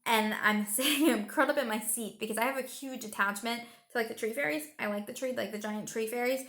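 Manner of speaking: ordinary talk, nothing marked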